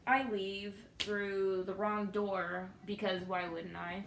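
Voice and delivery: normal talking voice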